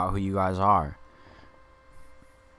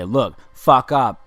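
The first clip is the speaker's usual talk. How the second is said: higher, louder, slow snapped order; mock angry shout; 5 semitones higher, 8 dB louder, slower than usual. Critics yelling at him to stop, slow loud shout. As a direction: bark it like the people scolding him.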